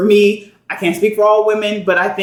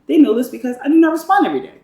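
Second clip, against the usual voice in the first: higher-pitched put-on food critic voice; food reviewer imitation; 7 semitones higher, much faster than usual. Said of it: "that was my imitation of him".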